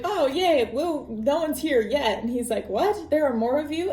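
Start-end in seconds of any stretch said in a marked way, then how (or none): 0.0-2.2 higher, lightly acted cheer; mock cheer. Arriving dwarves voiced in retelling. 2.7-3.9 higher-pitched incredulous question; mock surprise. Bilbo startled by more dwarves.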